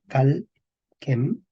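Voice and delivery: "calquem" very slow, cal, then quem; syllable by syllable. Shown as a demonstration. Teacher sounds out word for students, "calquem" slow and divided. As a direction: leave a clear gap inside "calquem".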